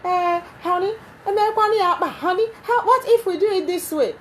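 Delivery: high, sugary pleading wife voice; sweet-talking wife. Retold or imitated. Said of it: "people who say".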